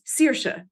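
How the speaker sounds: Saoirse pronounced "SEER-sha"; first syllable of Saoirse rhymes with "seer"; correct Irish Saoirse. Shown as a demonstration. Author teaching the Irish name Saoirse, "SEER-sha". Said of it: "That's how you say it".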